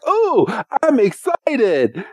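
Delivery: high, slow gushing "Ooh, I'm excited."; mock excited squeal. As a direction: coo it like an overeager kid. Timmy excited about nap.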